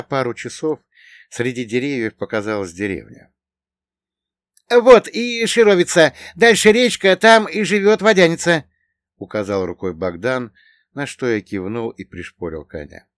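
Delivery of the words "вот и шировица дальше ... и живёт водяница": high, loud character voice; Bogdan, simple villager guide. A narrator's reading. Bogdan showing his companion the village and river, high-voiced guide.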